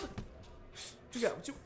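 slow whispered taunt. Acted. Boxer taunting rival, slow whisper. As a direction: breathe it out menacingly.

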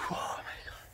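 geschrokken gefluisterd; fluisterend. Real, not acted. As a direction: fluister het zacht uit. Fluistert na de pijn. (ademloos gefluisterd)

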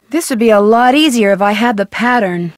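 ordinary talk, nothing marked

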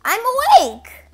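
greedy raccoon springing awake at the word cake; eager sudden waking. Acted. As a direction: blurt it out, suddenly alert.

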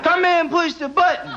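a hint of a lazy old man pleading; lazy old uncle. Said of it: "He's looking at my aunt going".

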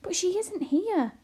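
high, slow, loud protest; swooping, dismayed objection; exclaiming. A narrator's reading. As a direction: exclaim in bewilderment. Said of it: "exclaimed Arrietty".

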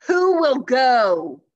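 high, very loud, slow call; booming. Acted. Christmas Knight asking the knights, booming. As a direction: boom the question across the table. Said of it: "he boomed".

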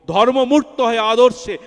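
proclaiming. Real, not acted. Preacher reciting scripture to listeners, loud and high. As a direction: ring out the scripture line.

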